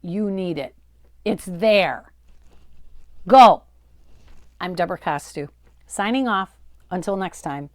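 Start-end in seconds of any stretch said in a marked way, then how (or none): 3.3-3.6 a sudden much louder push; loud command. Real, not acted. Host sends viewers to her courses, loud. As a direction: launch them out the door.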